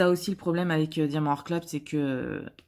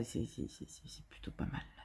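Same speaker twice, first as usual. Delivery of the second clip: breathy whisper to herself, much softer; 7 semitones lower, 17 dB quieter than usual. Real, not acted. Vlogger judging her canvas to herself, whispering. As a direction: murmur it privately, half to yourself.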